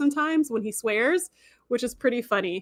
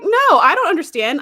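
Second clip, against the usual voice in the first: higher, louder objection; protesting; 7 semitones higher, 8 dB louder than usual. Retold or imitated. Fan reacting to Sisko's calm, a loud high protest. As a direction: push back hard in protest. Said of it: "I was like".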